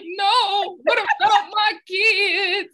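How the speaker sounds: put-on high, loud cry of "what about my kids"; distraught wife crying out on the phone; panicked shout. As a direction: protest loudly, slowing on the last words. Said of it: "And I was like".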